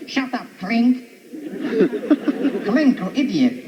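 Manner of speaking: slow, mocking Hogan; Hogan impression. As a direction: scold Klink slowly, mockingly. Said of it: "my brother's over there going".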